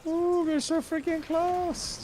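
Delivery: high, drawn-out excited squeal. Real, not acted. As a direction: stretch it out in high suspense. Tense moment in game.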